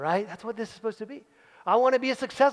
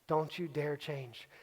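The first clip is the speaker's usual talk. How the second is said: slow hushed command; 6 semitones lower, 12 dB quieter, slower than usual. Acted. Second repetition of the command.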